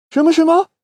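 high, eager surprise; surprised crowd. Acted. Crowd of chickens hearing about gold, high and surprised. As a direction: perk up at the news.

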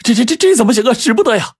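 very high, stammering alarm; frantic high-pitched objection; panicked crying out. Acted. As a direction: cry out in flustered protest. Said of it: "伙计们就叫喊起来".

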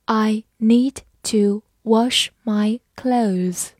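slow, word-by-word reading. Shown as a demonstration. Teacher reading slowly for students to follow. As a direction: read it slowly for the learners. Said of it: "好，慢慢来读。".

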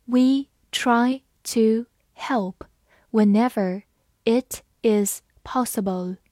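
slow, word-by-word model reading. Shown as a demonstration. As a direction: read it slowly with gaps between words. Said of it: "我们把完整的句子慢慢来读". (慢慢逐词朗读)

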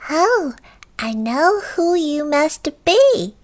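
high little goblin; high-pitched knowing creature. Acted. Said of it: "a little voice".

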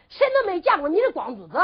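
annoyed maid. A narrator's reading. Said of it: "这个丫鬟可恼死了".

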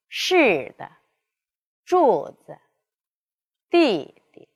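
falling first syllable, 的 子 弟 dropped light at the bottom; neutral tone demo. Shown as a demonstration. Teacher modelling neutral tone after fourth tone, careful. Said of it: "读作一度".

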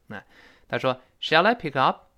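ordinary talk, nothing marked

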